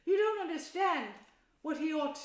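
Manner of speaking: loud, high cut-in; shouting. A narrator's reading. Mole cutting off Rat with his mouth full, shouting. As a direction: shout him down. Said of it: "shouted the Mole".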